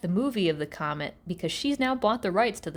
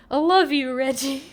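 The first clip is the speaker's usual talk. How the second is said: Archie; 3 semitones higher, 5 dB louder than usual. Retold or imitated. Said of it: "And that's 'cause".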